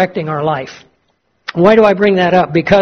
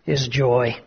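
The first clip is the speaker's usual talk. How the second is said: soft emphasis; 6 semitones lower, 8 dB quieter than usual. Real, not acted. Sermon climax softly delivered.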